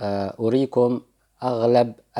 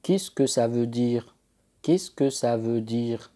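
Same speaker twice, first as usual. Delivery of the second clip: slow, careful French question; teacher modelling a French question slowly; slow model phrase; slower than usual. Shown as a demonstration. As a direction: drill it slowly for the class. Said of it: "لتقول".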